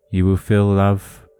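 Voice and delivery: ordinary talk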